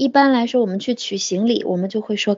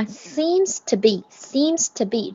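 Seems to be said slowly and evenly, twice; slow drill; 3 dB quieter, slower than usual. Shown as a demonstration. Teacher slowly drilling the phrase for the class. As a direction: lay out seems to be word by word, slowly. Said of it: "这样下去就行".

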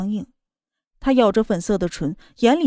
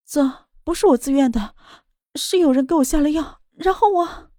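rising higher into tearful pleading; crying; 5 semitones higher than usual. Acted. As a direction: plead through tears, voice climbing. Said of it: "她哭着道".

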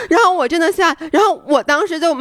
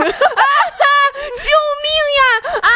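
high, slow, half-laughing cry for help; laughing scream for help; 13 semitones higher, much slower than usual. Retold or imitated. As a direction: laugh and shriek at once. Reenacts screaming at crab. (又笑又喊的高声呼救)

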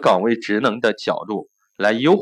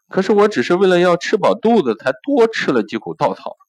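choked up; 8 semitones higher than usual. A narrator's reading. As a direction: voice rising high as it breaks. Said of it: "又哽咽起来".

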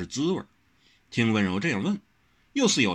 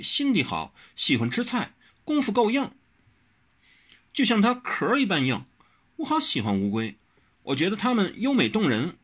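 higher, smiling, self-satisfied; delighted with her own idea; smiling and pleased; 11 semitones higher than usual. A narrator's reading. Wen Rou praising turtles, smiling and pleased. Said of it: "他说得笑眯眯、自得其乐的".

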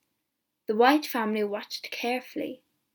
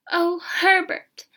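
drawn-out, higher, louder exclamation; anxious cry; 7 semitones higher, 8 dB louder, slower than usual. A narrator's reading. Mother afraid of losing her son, anxious cry. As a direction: cry out slowly in motherly alarm. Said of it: "his mother cried".

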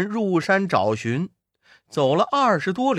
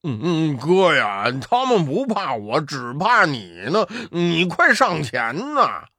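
八戒腔调. Acted. 八戒推悟空上前.

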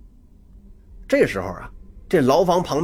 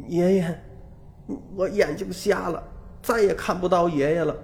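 put-on a touch of tearful, softer child's plea; mildly weepy, speaking through held-back tears; crying; 3 semitones higher, 5 dB quieter than usual. A narrator's reading. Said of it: "哭着说".

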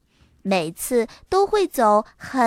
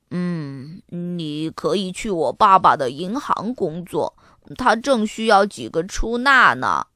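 a hint of a friend's character voice, lower at the start; low-starting, helpful suggestion; lightly voiced Muffy; 5 semitones lower than usual. Friend offers a job at her dad's bank, lightly voiced.

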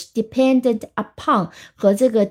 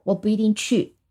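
ordinary delivery

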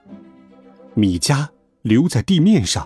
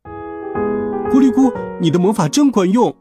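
high-pitched delighted cheer; 米迦, overjoyed; 8 semitones higher than usual. Acted. Crow cheering the magician's spell, overjoyed. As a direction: praise it in high, happy excitement. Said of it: "米迦高兴极了".

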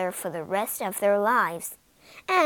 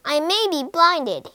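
louder, higher defiance; defiant shout; 6 semitones higher, 5 dB louder than usual. Acted. Blinded prince shouting at the witch, loud and defiant. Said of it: "he shouted to the witch".

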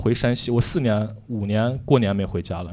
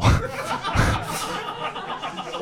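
laugh; 5 dB quieter than usual. Real, not acted. Laughs at his own story.

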